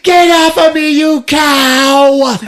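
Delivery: high, loud enraged screech; hammed-up hysterical fury; furious mock shout. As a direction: scream it while shoving someone off. Retold struggle, shoving off Iris.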